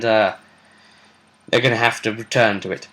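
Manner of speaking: ordinary talk, nothing marked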